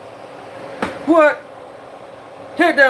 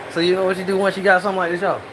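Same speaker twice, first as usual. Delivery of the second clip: ordinary delivery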